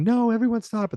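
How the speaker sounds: high-pitched mock yell. Casey yelling at the fighting husbands, high mock yell. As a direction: cry out over the brawl, half playing it. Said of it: "Casey starts yelling".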